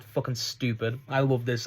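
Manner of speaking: normal talking voice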